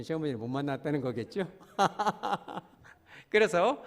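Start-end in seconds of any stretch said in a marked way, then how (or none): 1.6-2.6 a bright burst of laughter; hearty laugh. Real, not acted. Preacher laughing at his own joke about amen, hearty laugh. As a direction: laugh out at the congregation's silence.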